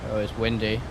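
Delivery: normal talking voice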